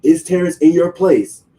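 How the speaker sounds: high, loud accusing question; going hard. Retold or imitated. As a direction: demand it, imitating an angry caller. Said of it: "now he going hard on the phone".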